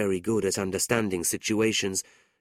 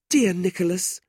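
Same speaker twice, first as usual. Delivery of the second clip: high, louder cry of his name; tearful cry; 10 semitones higher, 4 dB louder than usual. A narrator's reading. Kate cries out hugging her brother. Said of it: "Kate cried".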